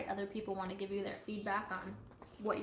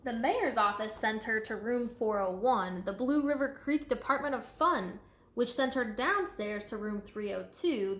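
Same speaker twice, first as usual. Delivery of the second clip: lively, sing-song storybook read; reading to children; 7 dB louder than usual. A narrator's reading. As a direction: read it out to a class with bounce. Reading picture book aloud.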